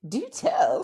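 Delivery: mock-genteel, high and loud; Bridgerton society lady. Co-host hears donation gossip, mock period-drama intrigue. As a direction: play the scandal-hungry period gossip. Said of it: "I feel like this is Bridgerton".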